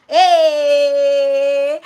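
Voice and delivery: a loud, bright hey to a newcomer; loud greeting. Real, not acted. Welcoming a returning viewer with a loud hey. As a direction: call out the hello.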